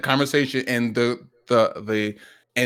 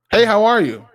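higher, louder chirpy greeting read out; bright mock message voice; 9 semitones higher, 8 dB louder than usual. Man reading his friend's dreaded message, mock cheery. Retelling the awaited DM.

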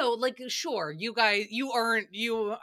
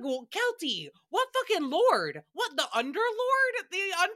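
incredulous retort; 10 semitones higher than usual. Retold or imitated. Podcaster answering Kelty, high mocking disbelief. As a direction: scoff at the idea. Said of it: "I was just like".

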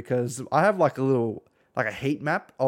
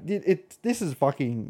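usual speaking voice

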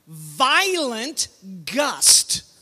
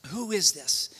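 hushed, reflective question; 6 semitones lower, 6 dB quieter than usual. Retold or imitated. Preacher repeating the disciples' question to the congregation, hushed. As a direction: lower and softer.

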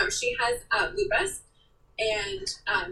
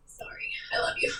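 breathy whispered apology, much higher; tender whisper. Retold or imitated. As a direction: whisper it like a secret sorry. Now apologizing to her mom.

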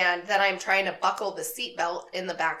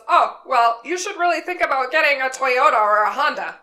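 louder, higher, know-it-all driver voice; imitating the driver; 6 semitones higher, 9 dB louder than usual. Driver pushing unasked car advice on passenger, smug mock voice. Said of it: "He goes".

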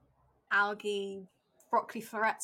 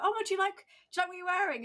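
a bright, much higher TV host voice, asking sweetly; chirpy morning-show host, pitched way up; high presenter voice; 9 semitones higher, much faster than usual. Retold or imitated. Presenter asking the woman about her dress, in a much higher sweet voice.

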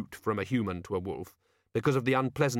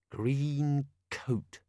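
thinking aloud; 4 semitones higher than usual. A narrator's reading. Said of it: "as if thinking aloud".

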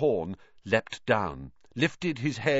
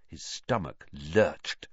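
plain reading voice

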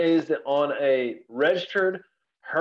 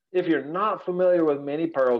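ordinary delivery

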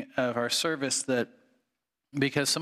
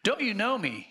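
mild shout; 8 semitones higher than usual. Acted. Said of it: "he shouted to the ghost".

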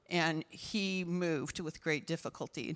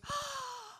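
high, airy gasp inward; big sharp inhale, imitating relief; imitated deep breath; 5 dB quieter than usual. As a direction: act out a sudden clear breath. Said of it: "And she goes".

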